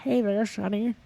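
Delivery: put-on a light Florida old-timer twang; Floridian accent. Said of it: "the Floridian accent was".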